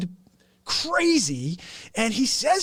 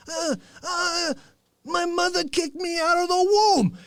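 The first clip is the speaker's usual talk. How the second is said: higher mock whiny voice; imitating Fauci; 6 semitones higher than usual. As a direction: lightly mimic the odd candidate. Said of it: "I've heard this every time he's on the air".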